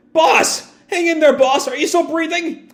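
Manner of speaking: high, panicked yelling; frantic calling out. Acted. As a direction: shout to a fallen leader. Kid's gang calls to him.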